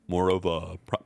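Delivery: low, smug streamer impression; lightly voiced smug streamer; Pirate Software voice. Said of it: "my pirate software voice".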